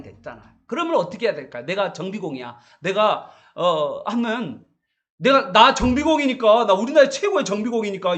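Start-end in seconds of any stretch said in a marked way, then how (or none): none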